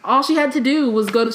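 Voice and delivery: ordinary delivery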